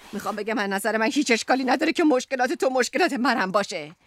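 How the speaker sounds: usual speaking voice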